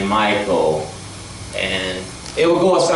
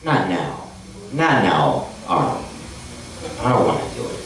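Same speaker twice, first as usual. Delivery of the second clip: Maria Shriver; 6 semitones lower, 4 dB quieter than usual. Retold or imitated. Maria refusing Arnold, low and quiet. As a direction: lower and softer, brushing him off. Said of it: "she's like".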